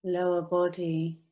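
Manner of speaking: slow meditation voice with long pauses; measured, drawn-out phrases, leaving space to breathe; guided meditation. Acted. Clinic worker leads online group through body relaxation, guided meditation.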